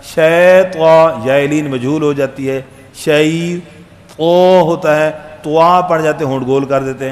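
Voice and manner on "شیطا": شیطا stretched very slowly and louder; drawn-out recitation. Shown as a demonstration. Teacher showing the doubled شین in شیطان. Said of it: "شین کی شد".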